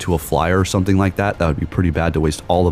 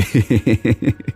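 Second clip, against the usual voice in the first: delighted laugh; 4 semitones higher than usual. Real, not acted. Laughing after a surprise win.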